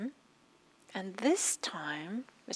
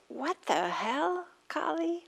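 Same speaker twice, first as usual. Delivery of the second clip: a slow, higher, exasperated scold; 9 semitones higher, slower than usual. Retold or imitated. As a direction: let her exasperation spill out. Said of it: "this exasperation".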